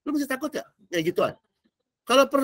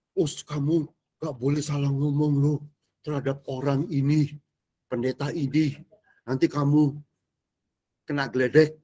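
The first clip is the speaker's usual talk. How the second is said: lower, hushed warning to a friend; fearful warning; 7 semitones lower than usual. Retold or imitated. Fearful churchgoer warning friend about the pastor, hushed and lower. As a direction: warn him off like a scared believer.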